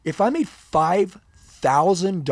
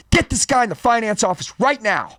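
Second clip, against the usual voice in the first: retold manager's loud, high, urgent shout; 7 semitones higher, 7 dB louder than usual. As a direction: shout orders at the staff to get moving. Retold manager urgently ordering the staff.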